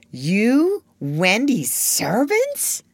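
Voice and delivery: slow, high, incredulous question; appalled disbelief. A narrator's reading. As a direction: draw out each word in astonishment. Boy appalled at servant idea.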